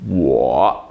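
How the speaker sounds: "Wo" low, long and dipping; third-tone demo. Shown as a demonstration. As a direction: drop deep on "Wo" for learners. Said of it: "the word I".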